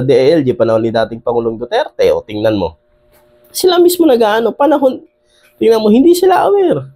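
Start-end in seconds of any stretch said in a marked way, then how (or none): 3.5-6.9 exasperated, as if he can't believe it; indignant outburst. Real, not acted. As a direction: voice jumps high, pushing each phrase out in disbelief. Outraged commentary on officials. (nagngingitngit na bulalas)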